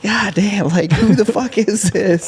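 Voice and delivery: hammed-up amazement at the passer; comic disbelief. Rider passed again in sand, mock stunned.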